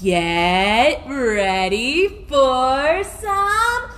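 very slow, stretched words rising to a burst; drawn-out build-up. Real, not acted. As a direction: tease out each word before FUN. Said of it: "we're gonna say".

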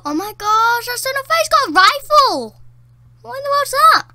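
high, loud gamer protest; outraged yelling. Real, not acted. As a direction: cry foul at the top of your voice. Outraged at enemy having a rifle.

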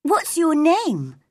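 put-on high, slow girlish question; young girl. A narrator's reading. Mary asks the gardener his name, in a high girl's voice. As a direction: pitch it up like a curious girl.

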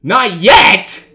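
loud, high burst of disbelief; incredulous shout. Real, not acted. Player reacting to the game's message, incredulous shout. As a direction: blurt it out loudly, pitch jumping up.

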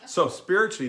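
laughing through the words; words spilling out of a laugh; still laughing. Real, not acted. Laughing after teasing his wife about the clean story. Restart after joke to Donna.

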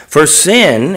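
"sin" drawn out with a pitch swell; stress on sin. Shown as a demonstration. Preacher reading a verse to show what caused this, stress on "sin". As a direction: lean hard on the word "sin". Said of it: "I put emphasis on the word sin".

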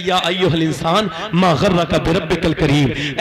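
slow, loud recited Arabic; loud Quranic recitation. Acted. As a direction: intone the verse slowly. (بلند آواز میں تلاوت)

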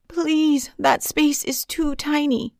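high, pleading cry; panicked cry. A narrator's reading. Boy crying to bullies about the tiny locker, panicked. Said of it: "he cried".

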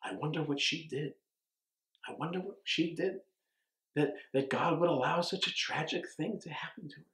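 softly voiced, prying wonder; mock gossip. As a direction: imitate the nosy question in a hushed tone. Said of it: "to ask me".

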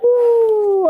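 high loud whine; loud wail. Real, not acted. Reacting to game prices, loud high wail. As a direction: let out a long loud groan-cry.

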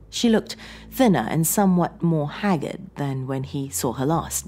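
usual speaking voice

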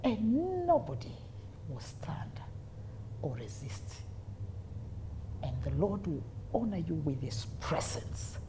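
quiet, solemn retold promise; hushed prophecy. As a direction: imitate a preacher blessing someone softly. Retelling the preacher's hushed prophecy.

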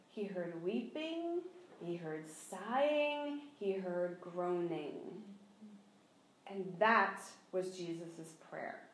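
slow hushed emphasis; slow solemn. Real, not acted. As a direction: linger on each word quietly.

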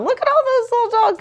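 very high, fast excited voice. Acted. Excited reading aside.

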